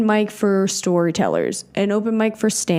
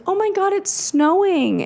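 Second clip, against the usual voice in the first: high, delighted gasp of a line; imitating a thrilled cousin; excited surprise; 11 semitones higher than usual. Cousin seeing snow through the window, high delighted surprise.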